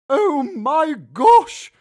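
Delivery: high, loud, drawn-out gasp of shock; wife startled at the lizard; stretched-out shocked exclamation; exclaiming. A narrator's reading. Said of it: "my wife exclaimed".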